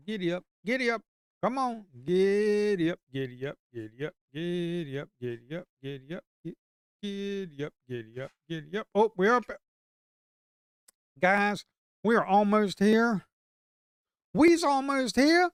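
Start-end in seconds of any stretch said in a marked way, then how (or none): 0.1-3.0 urging giddy up. Real, not acted. Trader urging price chart upward. 8.9-15.5 excited. Real, not acted. Trader excited price nearing target.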